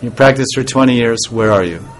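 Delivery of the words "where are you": normal talking voice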